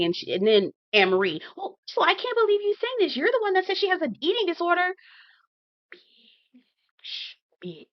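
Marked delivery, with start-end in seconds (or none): 1.6-4.9 high-pitched mock outrage; hammed-up high, incredulous accusation; imitating an indignant accuser. 5.9-7.9 barely audible, breath only; voiceless, appalled whisper; stunned whisper. Real, not acted. Stunned reaction to the accusation.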